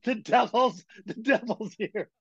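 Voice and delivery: high, panicked yell that the devil is here; terrified yell. Retold or imitated. Kid at the campfire yelling to friends, high panicked cry. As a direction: shriek it out like a scared boy seeing the devil. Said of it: "He yells at the top of his lungs".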